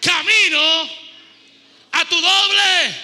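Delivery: slow, loud, high preaching proclamation; thundering prophet voice. Acted. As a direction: thunder each word out slowly. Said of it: "Levante su mano y diga conmigo". (voz de profeta atronadora)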